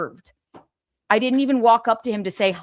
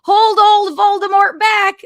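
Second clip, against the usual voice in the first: higher, louder mock call to hold him back; hammed-up, much louder imitation of the handlers; mock shout; 9 semitones higher, 8 dB louder than usual. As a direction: bellow it like frantic handlers, half-joking. Mocking the handlers' cries.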